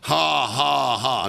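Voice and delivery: deliberate ha-ha dropping in pitch; imitated laugh in answer to the devil; forced laugh. Preacher retells laughing at the devil, forced laugh. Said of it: "That's why I'm laughing".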